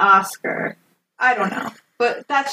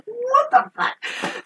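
very high, drawn-out incredulous squeal; mock horrified disbelief; much slower than usual. Blanche learns Buck shot a cop, mock horrified. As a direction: stretch it out high in mock shock.

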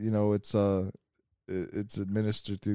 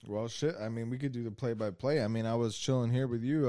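ordinary talk, nothing marked

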